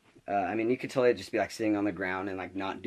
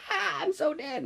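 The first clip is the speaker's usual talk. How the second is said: very high, squealed mock panic; hammed-up high shriek with a laugh; mock freaking out; 3 dB louder, slower than usual. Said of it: "not, like, freaking out, like".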